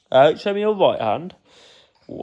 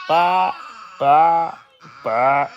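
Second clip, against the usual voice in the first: short playful bleats; sheep bleat; much slower than usual. Pretend. Dad showing his child a sheep sound, playful bleating. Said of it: "What about a sheep sound?".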